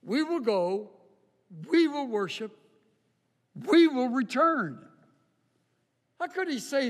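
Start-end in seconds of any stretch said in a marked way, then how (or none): 0.0-4.8 rising, louder repeated "we" each time; emphatic we. Acted. Preacher declaring Abraham's faith, stressing every "we". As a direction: build the three "we" lines like a proclamation. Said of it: "notice what he calls the sacrifice".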